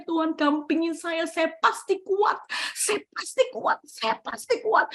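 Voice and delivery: repeating a vow through tears; tearful declaration. Retold or imitated. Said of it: "sambil bertetes air mata".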